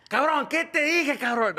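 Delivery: high, loud, slow scolding dad in Spanish; fake dad voice. Friend faking dad to fool teacher, loud slow scold. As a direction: friend posing as angry father. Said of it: "talking like my dad".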